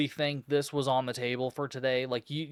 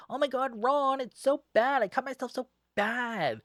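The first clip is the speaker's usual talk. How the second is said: much higher, overdramatic damsel wailing about a small cut; mock melodramatic whine; 11 semitones higher than usual. Mocking Gina whining to Ron about her cut, hammed-up high wail. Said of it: "Gina acts like this is the end of the fucking world".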